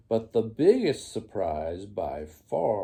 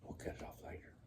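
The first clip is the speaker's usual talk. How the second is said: whispered aside; 18 dB quieter, much faster than usual. Real, not acted. Aside to child.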